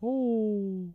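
very high, loud giggly cartoon voice; Pillsbury Doughboy voice. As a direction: squeal it like a doughy mascot. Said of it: "the Pillsbury Doughboy was here".

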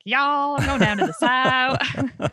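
high, slow mock Southern drawl; Irish speaker's playful Southern US impression; American Southern accent. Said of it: "when we think of American accent".

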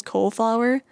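"cauliflower" said as COLE-flower; rejected pronunciation. Shown as a demonstration. Vlogger mocking the disputed version, cauliflower as Cole-flower. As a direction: drop the middle syllable of "cauliflower", Cole-flower. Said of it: "if you know someone who says".

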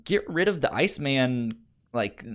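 normal talking voice

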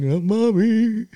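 high, slow whimper calling for mommy; hammed-up inner sob for mommy, slowly; mock crying. Pretending to cry after the caller's scolding, mock whimper. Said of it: "I'm crying".

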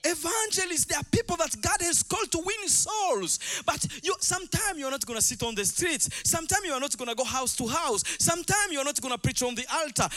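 high, loud preacher's proclamation; full-force preaching. Real, not acted. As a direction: proclaim it to the whole congregation, ringing and forceful.

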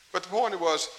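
ordinary talk

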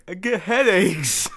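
slow, teasing echo of a friend; mocking repeat. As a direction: drag it out to tease.

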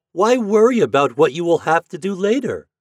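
lifted, emphatic reassurance; encouraging cry. A narrator's reading. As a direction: cheer on a worried little friend. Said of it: "they cried".

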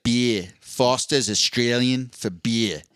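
slow Aussie tagline; Australian accent. Shown as a demonstration. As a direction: demonstrate an Australian accent, drawing out the words. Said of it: "I can't do an Australian accent".

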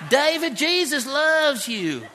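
high, loud and slow mock preacher drawl with a laugh; imitating caveman's accent. The caveman inmate preaching to young David in a hammed-up accent. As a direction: ham up the earnest prison evangelist, slowly. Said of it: "you got a firme accent".

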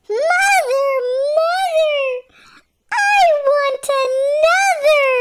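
high, loud wail; a child crying out in protest; wailing baby elf. A narrator's reading. Baby elf wailing at Missus Frog, high and loud.

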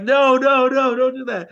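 alarmed protest. Retold or imitated. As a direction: stop him, half-panicked. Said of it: "And [name]'s like".

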